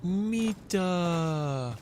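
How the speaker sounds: high, ringing shout of "Mita"; enemy AI callout. Retold or imitated. Streamer mimics the enemy's high Finnish shout. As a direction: call it like the enemy soldier. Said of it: "That's exactly what I said".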